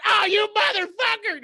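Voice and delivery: high, loud, slow panicked yell; terrified roller coaster scream. Retold or imitated. Uncle on a roller coaster drop, imitated terrified scream. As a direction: scream it like the coaster just dropped. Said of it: "He's just legitimately terrified".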